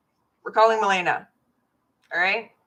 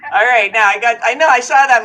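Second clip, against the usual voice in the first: loud; 3 semitones higher, 11 dB louder than usual. Real, not acted. Call connects, talking over speakerphone.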